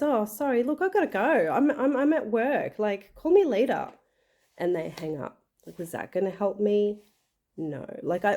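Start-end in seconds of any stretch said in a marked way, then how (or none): 0.0-3.9 higher, hurried brush-off from someone at work; busy brush-off. Retold or imitated. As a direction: sound like you're cutting the call short. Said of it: "and actually says".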